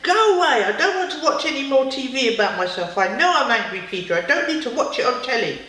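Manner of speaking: loud, high, furious go away; angry shout. Acted. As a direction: yell it like a cross little boy.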